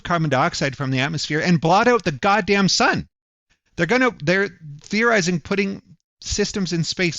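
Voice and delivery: loud, high, fast outraged tirade; furious rant. Real, not acted. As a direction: rail against them with real fury. Angry rant about enemies.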